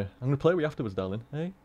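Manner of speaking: soft cat talk. Real, not acted. Promising the cat playtime.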